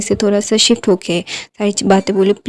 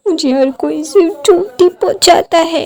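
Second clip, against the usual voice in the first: higher, tearful complaint; starting to cry; 8 semitones higher, 4 dB louder than usual. Acted. Wing complains to the old alpha, a little tearful. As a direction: whimper it like a hurt child. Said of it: "ऐसा बोल के वो रोने लगता है".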